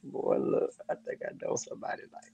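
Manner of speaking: hushed aside; whisper. Real, not acted. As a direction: whisper it conspiratorially. Whispered aside about someone.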